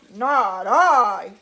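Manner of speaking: low, loud gruff voice; gruff it out like a big dog. A narrator's reading. Dog refusing to help the hen, low gruff voice. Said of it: "said the dog".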